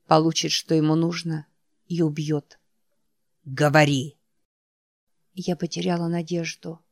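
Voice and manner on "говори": harsh command. A narrator's reading. Torturer's command in a nightmare.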